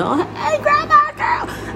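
put-on high singsong hello to a baby; doting grandma greeting. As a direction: mimic a gushing grandma on a video call. Imitates grandmother greeting baby again.

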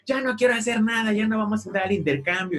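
mock angry outburst; angry parent. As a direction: quote the fed-up parent calling it off. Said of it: "mi papá se enojó".

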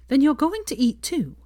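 high, firm scolding; strict. A narrator's reading. Hermione orders Draco to eat, strict. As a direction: lay down the rule like a strict teacher. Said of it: "said Hermione strictly".

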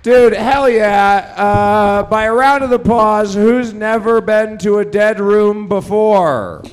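loud, high, slow show-opener call; hype host. Acted. Host warming up the crowd, loud and slow. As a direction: pump up the crowd like an MC.

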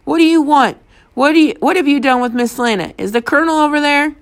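high, urgent questioning; indignant interrogation; angry demand. A narrator's reading.

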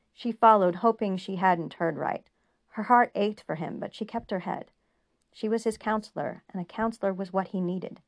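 ordinary delivery

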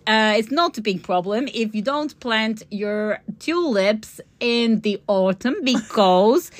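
put-on careful, playful Dutch accent. Dutch grower advising on late tulip planting, Dutch accent. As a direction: imitate a Dutch grower giving advice. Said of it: "shall I do my Dutch accent".

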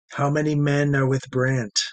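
ordinary delivery

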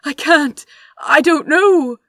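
high, loud, pleading panic; desperate. A narrator's reading. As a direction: beg through rising fear. Desperate denial.

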